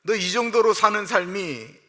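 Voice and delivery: loud, high challenging rebuke from God; God's stern rebuke. Retold or imitated. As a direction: press him with a hard question. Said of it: "하나님이 그렇게 말씀하고 계시는 거예요". (하나님이 예레미야를 다그치듯 크고 높게 꾸짖음)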